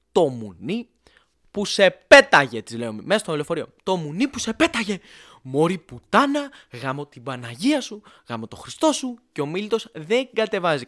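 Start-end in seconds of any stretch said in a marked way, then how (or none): 0.1-2.6 acted outburst, slow build to a loud yell; outraged cursing. Acted cursing at friend on phone. 3.8-9.2 re-enacted swearing fit, pitched up; furious cursing tirade. Acted cursing tirade on the bus.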